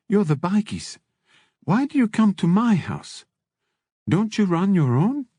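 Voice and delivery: probing madam in a high voice; Ava. A narrator's reading. Madam quizzing the bikies, high inquisitive character voice. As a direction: pitch it high, questioning pointedly.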